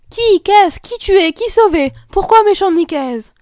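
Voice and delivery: high, loud confused questions; bewildered mother. Acted. Mother bewildered by the girls' shouting, confused. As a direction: try to follow the chaos.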